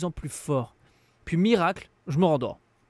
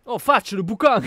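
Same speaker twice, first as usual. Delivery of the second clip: loud, high, laughing cry; excited laughing outburst; 8 semitones higher, 8 dB louder than usual. Real, not acted.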